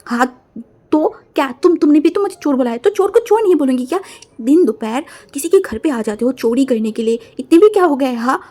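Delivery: heated accusing shout; angry quarrel. Acted. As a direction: tell him off, outraged.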